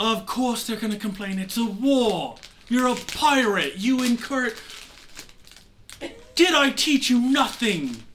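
high, loud, mounting outrage; angry scolding. Acted. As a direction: berate a useless pupil, voice rising. Mentor berates pupil for stopping his war.

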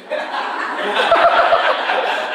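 high breathy giggle; high breathy laugh. Real, not acted. Preacher laughs at his own joke, high and breathy.

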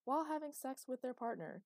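an eighties training-tape narrator, bright and overeager; hammed-up announcer reading an old rehab video; cheesy video narrator. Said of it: "continued the narrator".

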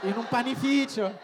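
high, slow wail with laughter; mock crying. Interpreter copying the pastor's tearful announcement, laughing mock wail. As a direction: echo the fake lament, amused.

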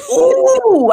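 high, loud "ooh" of sudden recall; pained ooh. Real, not acted. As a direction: let out a high drawn ooh. Suddenly remembering a sore detail.

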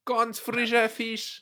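high, slow hawker's call; market crier. Pretend. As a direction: call it out like a fish seller. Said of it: "als Marktschreier".

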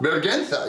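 Vergüenza said with a hard G, no W sound; Spanish pronunciation. Shown as a demonstration. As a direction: say Vergüenza as ber-GEN-tha. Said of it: "yeah, exactly".

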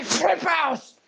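high squealing mock panic; mock freak-out. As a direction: shriek it like losing it.

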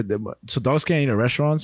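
usual speaking voice

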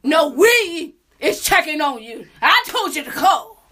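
barge in loudly over the call; loud interrupting friend. Retold or imitated. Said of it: "this motherfucker to my left says".

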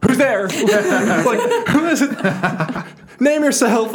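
high, loud, laughing mock shout. Kid calling to shadows in the dark, laughing mock shout. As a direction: call into the dark like a scared kid. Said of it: "I was, like, shouting".

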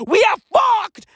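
loud, high cry of doom; we are fucked, shouted; panicked shout. Acted. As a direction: yell it out in fake terror.

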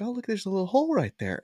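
high, quick, innocent chirp; naive girl. Retold or imitated. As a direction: imitate a clueless girl making a discovery. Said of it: "she would definitely be the girl".

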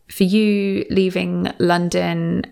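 ordinary delivery

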